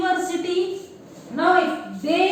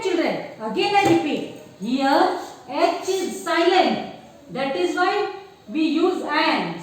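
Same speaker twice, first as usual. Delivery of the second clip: usual speaking voice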